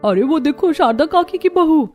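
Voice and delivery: neighbour women. Acted. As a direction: call her over brightly as a village neighbour.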